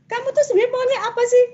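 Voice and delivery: high, fast exasperated scolding; sharp impatient parent question, high and quick; impatient demanding parent. Shown as a demonstration. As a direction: snap it at the child like an annoyed parent. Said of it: "Bukan begitu gitu ya".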